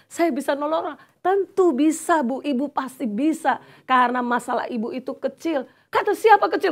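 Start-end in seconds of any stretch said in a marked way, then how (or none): 5.9-6.7 sharp, much higher and louder protest; angry retort. Retold or imitated. Woman offended that her problem is called small, angry high retort. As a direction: snap back, offended. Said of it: "Kan marah lagi".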